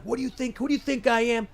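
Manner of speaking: high, indignant mock yell at a friend; retold angry yelling. Guy yelling at his friend over a burger request, high indignant mock yell. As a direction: throw the question back, offended and rising high. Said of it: "he started yelling at him".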